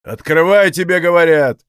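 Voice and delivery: loud, insistent order; shouting from outside. A narrator's reading. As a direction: call out loudly to be let in. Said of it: "кричали с надворья". (крик с надворья)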